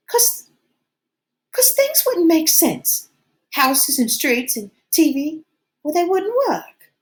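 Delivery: fretful, pushing Ralph to act; lightly voiced Piggy. A narrator's reading.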